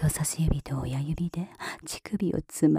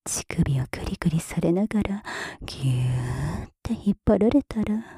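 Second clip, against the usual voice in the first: normal talking voice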